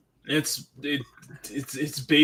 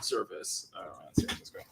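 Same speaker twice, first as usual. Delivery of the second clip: soft, lower whisper; whispered aside; 7 semitones lower, 11 dB quieter than usual. Real, not acted. Host whispering an aside to the co-host. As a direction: drop to a confiding whisper. Aside after self-driving chat.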